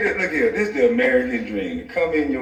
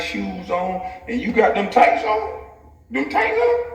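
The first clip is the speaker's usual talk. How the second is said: mock incredulous; 9 semitones higher, slower than usual. Mocking stylish bonnet girls' outfits.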